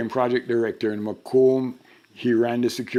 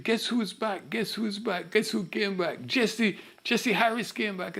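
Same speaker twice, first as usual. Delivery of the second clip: excited gossip; 10 semitones higher than usual. Retold or imitated. Crowd buzzing at the reading.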